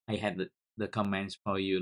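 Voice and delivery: ordinary talk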